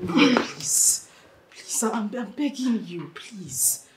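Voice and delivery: soft, hushed pleading; tearful begging. Acted. Daughter kneels before her mother to beg, soft pleading. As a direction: beg weakly, barely getting the words out.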